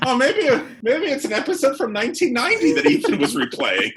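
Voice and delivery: high-pitched giggly protest; laughing high. Real, not acted. Teasing the host, laughing in a high voice. Joking about being an old-timer.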